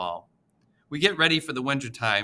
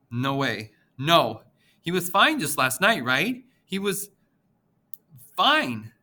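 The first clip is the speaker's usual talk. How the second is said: disbelief; 5 semitones higher, 3 dB louder than usual. A narrator's reading. Girl denying grandpa's death.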